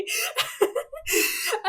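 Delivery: high, breathless happy laugh; giddy laugh. Real, not acted. Gamer overjoyed at her pull, giddy laugh. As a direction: laugh out of pure joy.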